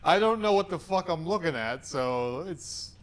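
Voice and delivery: ordinary delivery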